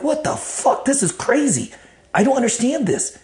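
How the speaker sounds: high-pitched retold disbelief; high, incredulous protest; bewildered outburst. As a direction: relive being utterly baffled, pitch way up. Retold bewilderment at a crazy event.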